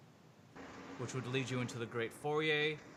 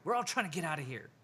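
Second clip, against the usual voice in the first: frustrated guy; 5 semitones higher, 7 dB louder than usual. Acted. GM voices panicked NPC.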